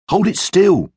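put-on high, insistent boy's command; urgent order. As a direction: tell your mate off while pouring. Matt orders friend while pouring.

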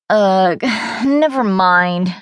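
slow, sighing groan of defeat. A narrator's reading. Maddie giving up on explaining to Katie, slow groan. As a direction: let it out on a weary sigh. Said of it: "I groaned".

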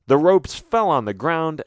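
ordinary delivery, nothing marked

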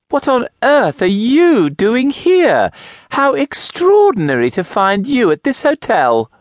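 an effusive widow startled by a friend; overjoyed widow greets her neighbour, gushing. A narrator's reading.

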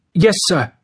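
lightly voiced young prisoner; obedient young man. A narrator's reading. George replying to the police officer, obedient.

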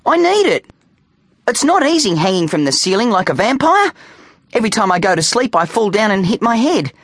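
put-on high-pitched child's complaint; protesting. A narrator's reading. Boy begging mother for his bike helmet, protesting. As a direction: argue up high like a pleading boy. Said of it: "he protested".

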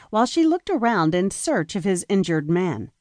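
ordinary delivery

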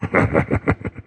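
low, gravelly scornful chuckle; the villain's low, gravelly mocking laugh. A narrator's reading. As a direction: laugh low at his foolish guess. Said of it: "The gravelly voice laughed".

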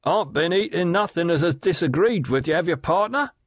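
high, sly leering call across the water; leering waterman. Acted. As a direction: Riderhood hailing Gaffer with a sly leer, pitched high. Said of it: "acknowledged it with the retort".

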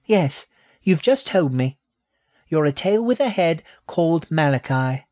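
put-on high, cheeky childlike voice; a pert little rat. Eve answers snake.

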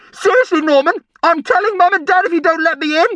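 put-on high, whining boy's shout; agitated threat. A narrator's reading. Brian threatening to tell Mum and Dad, agitated.

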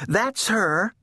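high little-sister voice; timid little sister. A narrator's reading. Baby points out her sister, high and timid. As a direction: confirm timidly, pitched high.

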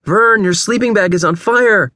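high, urgent shouted warning; panicked call to a friend; alarmed warning. A narrator's reading. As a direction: shout that there's danger, pitch way up. Warns friend of fire.

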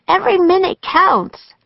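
high, pressing voice; urgent insistence. A narrator's reading. Annie hurrying Jack to rescue Morgan, urgent insistence. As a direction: press the point, pitched high.

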